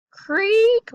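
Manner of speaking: high, long rising creak; creaking stair. A narrator's reading. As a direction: stretch the word like a squeaky step. Voicing creaking stair in story.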